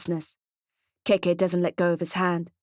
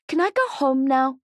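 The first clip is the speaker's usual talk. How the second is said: put-on higher child's voice, eager; little boy; 6 semitones higher, 3 dB louder than usual. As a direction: speak up high like a small boy. Young boy Mally asks.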